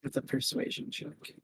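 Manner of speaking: soft unvoiced repeat; whispered echo. Real, not acted. Player murmurs the DM's words back, whispered. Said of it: "repeats it whispered".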